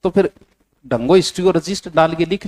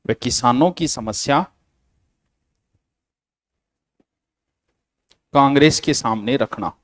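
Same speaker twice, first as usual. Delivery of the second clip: measured note dictation with long pauses. Real, not acted. Teacher reading out notes for students to copy, dictation. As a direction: dictate phrase by phrase for students to write.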